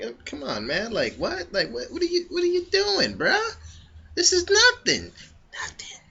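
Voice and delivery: high, mocking, nonchalant brush-off; unbothered Madara. Madara shrugging off Sasuke's attack, high mocking scoff. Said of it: "He's like".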